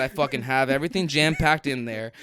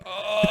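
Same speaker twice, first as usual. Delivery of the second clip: long, higher "ohhh"; mock dread moan; 6 semitones higher, 3 dB quieter than usual. Rider retelling the whole spinning ordeal, long mock moan. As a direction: act out a drawn-out wail on the ride. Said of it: "I'm like".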